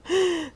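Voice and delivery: high happy giggle; delighted laugh. Real, not acted. Delighted by new ghost puppy.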